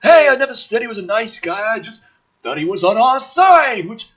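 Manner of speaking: high, loud brash military man; gruff officer voice. Acted. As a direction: play the cocky colonel loud and high. Woodhurst voiced in script.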